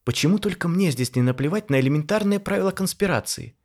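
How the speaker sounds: lightly voiced fed-up lady; exasperated woman. A narrator's reading. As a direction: vent at the careless pair.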